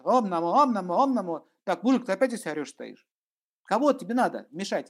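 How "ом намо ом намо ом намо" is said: yelling mantra. Retold or imitated. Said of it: "стоит орёт".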